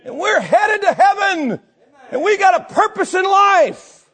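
very high, loud pulpit shouting; fired-up preaching. Real, not acted. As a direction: holler it with urgent fervour. Preaches at full force.

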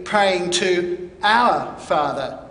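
our drawn out and leaned on, very slow; very slow, the weight on our; stress on our. Shown as a demonstration. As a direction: dwell on our before Father. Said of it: "emphasis on the word our".

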